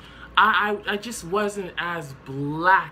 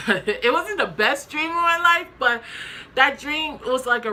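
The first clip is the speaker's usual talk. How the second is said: laughing; 10 semitones higher, 7 dB louder than usual. Real, not acted. Laughing at own dream story.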